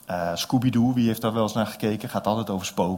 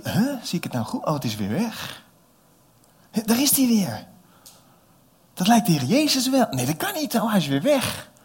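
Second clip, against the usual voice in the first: high, wondering voice of doubt; disbelieving disciples; 10 semitones higher, 4 dB louder than usual. Acted. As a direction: peer out at the waves, unsure. Disciples see Jesus on waves. (verbaasde discipelen)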